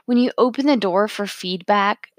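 usual speaking voice

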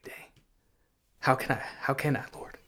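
retelling a stunned, whispered plea; overwhelmed. Retold or imitated. Man retells answering God when it hit him hardest, overwhelmed. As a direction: half-whisper it, as if struck.

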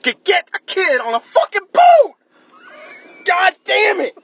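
furious shout. Real, not acted. Critic fed up with the boat scene, furious shout. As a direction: blow up at the movie. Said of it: "You are really fired up about this".

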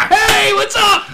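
slow, high, loud shouted greeting; shouting. Retold or imitated. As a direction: yell it across the room. Dad shouting to quarterback.

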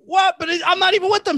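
high, quick, indignant pleading; retold protest. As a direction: act out protesting your innocence in a hurry. Retells his protest to the guard.

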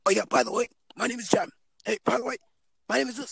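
high, offhand put-on voice; mock casual intro. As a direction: tease someone who introduces too late. Mocking late introductions.

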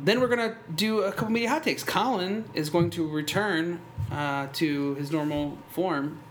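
announcer voice. Acted. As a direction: sell the show opening. Announcer rundown of episode.

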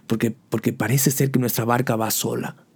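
usual speaking voice

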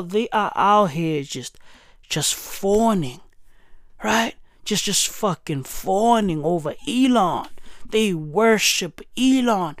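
ordinary talk

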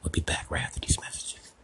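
whispered break announcement; whispered sign-off. Acted. Host going to a break, whispered announcer line. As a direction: breathe it out like a hushed announcer.

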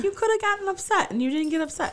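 high, fast quote through laughter; laughing quote. Retold or imitated. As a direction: giggle out the stranger's praise. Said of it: "she was like".

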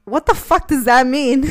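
loud laughing what-the; half-laughing, half-shouting; laughing disbelief. Retold or imitated. Retelling the boyfriend's answer, laughing loud. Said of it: "And I was like".

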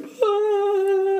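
long high-pitched fake crying wail; imitated little-boy bawl, held high; mock kid's wail. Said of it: "Dana's, like, crying".

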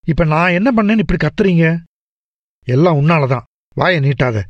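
usual speaking voice

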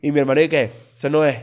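normal talking voice

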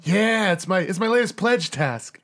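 keen, hapless pledge; eager pledge voice. Acted. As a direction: explain eagerly in a high voice. Dog Shit explains his pledge task.

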